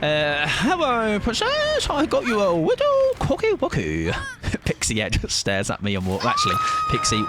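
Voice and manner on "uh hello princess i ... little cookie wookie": high sugary princess voice. Acted. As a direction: coo it like a cartoon princess. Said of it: "like, the princess voice".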